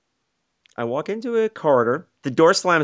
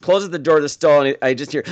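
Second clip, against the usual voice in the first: usual speaking voice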